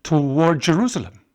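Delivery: stammering. A narrator's reading. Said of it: "stammering slightly".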